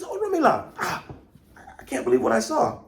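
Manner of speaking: high, fast, breathless with excitement; excited Ogun. Acted. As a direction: burst in thrilled at what he saw. Ogun returns wide-eyed with excitement.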